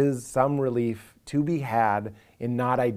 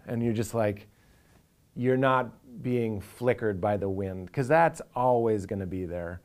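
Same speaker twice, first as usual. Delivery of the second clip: ordinary talk, nothing marked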